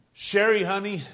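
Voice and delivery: put-on loud grieving call to his dead child; anguished cry. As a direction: cry out her name in anguish. Grieving father calls dead daughter.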